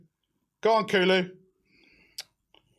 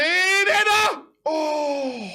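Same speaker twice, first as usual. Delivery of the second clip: high, loud cry at the header; shouted header then groan; excited shout; 10 semitones higher, 4 dB louder, much slower than usual. Real, not acted. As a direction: yell as the chance goes begging. Near miss header.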